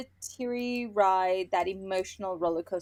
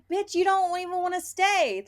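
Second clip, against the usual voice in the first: sassy retort; 9 semitones higher than usual. Retold or imitated. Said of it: "I'm like".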